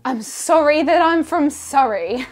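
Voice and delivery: fake-sorry sarcasm; sarcastic. Real, not acted. Sarcastic reply to accent comment.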